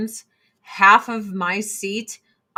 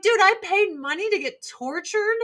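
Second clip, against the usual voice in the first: mock exasperated reply; 7 semitones higher than usual. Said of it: "And I'm like".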